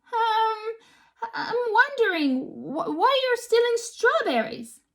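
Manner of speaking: high, hesitant stammer; timid child. A narrator's reading. Lily timidly asks the Vikings why they steal, high and hesitant. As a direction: nervously stumble through the question.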